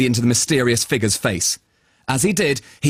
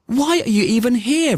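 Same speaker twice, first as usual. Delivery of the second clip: put-on little boy voice; 10 semitones higher than usual. Travis asks unicorn.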